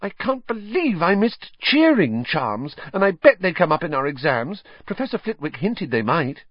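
a stressed schoolgirl worrying about exams; voiced high, anxious young witch; Hermione, harassed.

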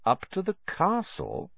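high, silky mock question. A narrator's reading. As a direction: echo him silkily, rising in false surprise. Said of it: "said Snape silkily".

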